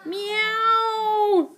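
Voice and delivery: long, high meow; hammed-up cat scream. A narrator's reading. Cats hit by the slimy sea, screaming. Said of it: "screamed the cats".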